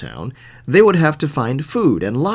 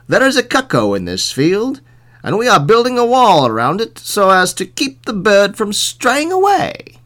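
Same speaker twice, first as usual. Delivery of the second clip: high, raised explanation; foolish wall builders; 8 semitones higher, 5 dB louder than usual. A narrator's reading. Builders explaining their wall to the sheriff, high and raised. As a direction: climb higher and draw out the ending.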